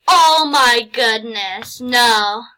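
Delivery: drawn-out, shouted oh my God; can't believe the gift, yelling it out; loud disbelief. Real, not acted. Gamer yelling at a donor's gift in loud disbelief.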